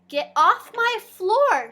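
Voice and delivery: sharp, loud, slow scold from an angry mother. Acted. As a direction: snap it like a cross mom catching muddy feet.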